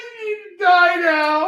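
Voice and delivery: high, loud crying shout; hammed-up Crow threat. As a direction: scream it like a vengeful ghost. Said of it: "I'm doing my Crow impersonation".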